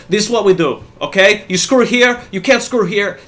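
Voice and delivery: hammed-up take on a know-it-all installer; bossy Russian foreman. Imitating the Russian installer giving orders, mock bossy. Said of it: "He's like".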